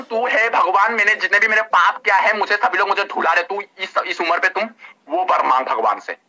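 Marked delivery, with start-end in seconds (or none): none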